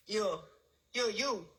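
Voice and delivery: usual speaking voice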